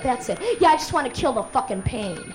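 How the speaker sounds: ordinary talk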